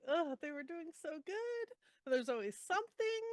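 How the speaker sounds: high, whiny mock despair; exasperated lament. Reader groaning that they were doing so good, exasperated lament.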